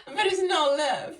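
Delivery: amused, breaking into a laugh. Real, not acted. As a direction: giggle out the punchline. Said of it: "I don't know why I'm laughing".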